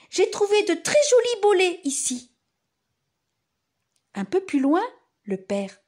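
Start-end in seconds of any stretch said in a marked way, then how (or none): 0.1-2.3 excited. Acted. Mother voiced, excited.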